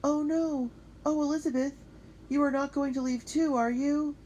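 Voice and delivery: high, loud anxious plea; a girl afraid her best friend will leave; dismayed pleading. Acted. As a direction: beg her not to go too. Felicity fears friend leaving.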